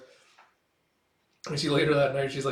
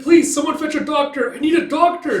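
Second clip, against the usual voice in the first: high, loud desperate plea; panicked plea; 14 semitones higher, 9 dB louder than usual. Retold or imitated. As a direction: beg for help in panic. Girl begs for help, panicking.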